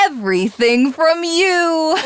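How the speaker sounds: villain laughing madly at the defeated heroes; maniacal laugh. Acted. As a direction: break into mad triumphant laughter.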